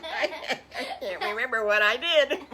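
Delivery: real helpless laughter, high and loud; laughing too hard to go on; cracking up. Real, not acted. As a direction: burst out laughing at your own lapse. Forgets her line and cracks up.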